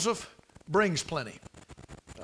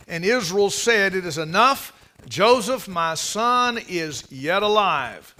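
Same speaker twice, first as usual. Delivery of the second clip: louder, ringing scripture read; preaching the verse; 4 dB louder, slower than usual. Acted. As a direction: proclaim it to the congregation.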